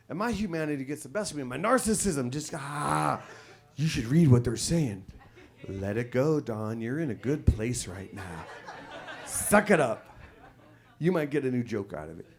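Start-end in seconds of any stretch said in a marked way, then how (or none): 2.5-12.2 inner voice. Retold or imitated. Inner voice talking to Don.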